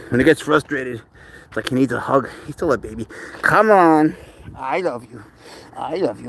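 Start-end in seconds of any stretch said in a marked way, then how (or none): 4.6-6.3 tender hush. Real, not acted. Hugging upset cat.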